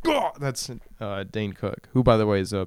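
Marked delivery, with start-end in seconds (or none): 0.0-0.3 high startled yelp. Retold or imitated. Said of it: "and you're like".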